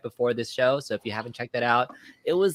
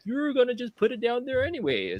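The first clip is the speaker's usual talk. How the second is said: higher voice quoting the wife; imitating his wife; 12 semitones higher than usual. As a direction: playfully mimic a nagging wife. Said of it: "So she's like".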